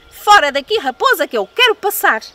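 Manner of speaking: loud bossy shout; shouting at the fox. Acted. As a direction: order the fox away, shouting. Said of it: "gritou-lhe".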